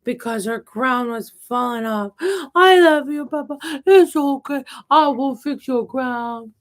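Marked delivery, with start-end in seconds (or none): 2.5-6.5 tender comforting. Acted. Comforting a broken toy Peppa.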